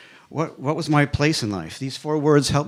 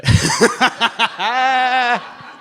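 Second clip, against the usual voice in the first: high, sustained burst of laughter; 10 semitones higher, 4 dB louder than usual. Real, not acted. Speaker breaks off mid-example to the audience, high laughing. Laughs during own talk.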